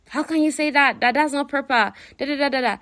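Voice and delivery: high-pitched, indignant objection; shocked protest. Retold or imitated. James reacting to Jane's claim about his friend, shocked. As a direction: imitate someone scandalised, pitched well up. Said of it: "a huge shock to James".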